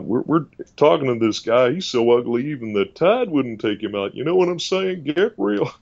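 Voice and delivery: Texan TV therapist impression, pitch climbing; Doctor Phil. TV therapist tossing out Texan sayings, put-on Texan voice. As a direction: trade folksy sayings, rising at the end. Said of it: "all these Texan sayings".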